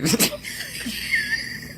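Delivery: high squealing laughter, nearly crying; shrieking laugh. Real, not acted. Reader cracking up at her own bunny tale, shrieking laugh. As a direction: laugh helplessly till it squeaks.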